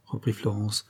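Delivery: usual speaking voice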